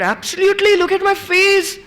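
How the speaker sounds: high, excited, bright-eyed certainty; high-pitched eager "absolutely"; eager insistence. Retold or imitated. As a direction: insist you're ready, pitch way up. Retells eager reply to sister.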